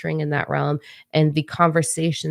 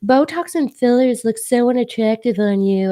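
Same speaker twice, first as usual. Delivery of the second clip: high, loud mock catty voice; snide commenter; 8 semitones higher, 6 dB louder than usual. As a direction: mimic a snotty troll. Quoting mean commenter.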